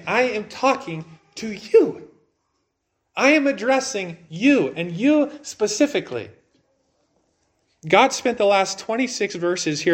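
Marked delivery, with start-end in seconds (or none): 0.1-6.3 weight on "you", higher and deliberate; emphatic divine address. Acted. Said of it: "what God is saying".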